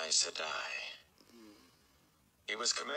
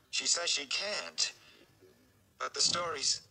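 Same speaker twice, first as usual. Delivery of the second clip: put-on higher, pleading young man's voice, close to tears; anxious young Rand; 6 semitones higher than usual. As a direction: beg the Aes Sedai for help. Rand pleads for his father.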